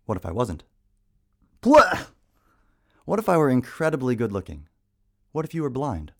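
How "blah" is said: loud blah. Acted. Sudden outburst mid poem.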